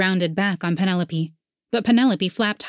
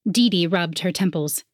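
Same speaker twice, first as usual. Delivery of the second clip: usual speaking voice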